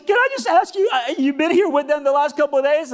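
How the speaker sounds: very high, loud, worked-up woman's voice; high-pitched imitation of a distraught lady; tearful cleaning lady. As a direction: squeal it as an overwrought hotel cleaner. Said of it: "I'm sorry to be emotional".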